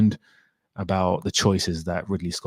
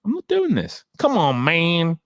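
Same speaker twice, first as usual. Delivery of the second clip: much higher, pleading mock refusal; hammed-up fed-up fan, higher and higher; exasperated protest; 8 semitones higher than usual. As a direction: whine it like you can't believe it. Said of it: "I was like".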